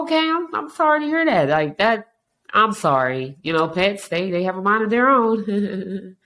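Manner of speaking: high, sing-song sympathy; sympathetic reply. Retold or imitated. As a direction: soothe them in a lilting voice.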